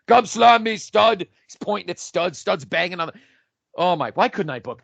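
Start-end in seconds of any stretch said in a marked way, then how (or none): 0.1-1.2 Andre yelling. Retold or imitated. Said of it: "in that deep Andre French voice".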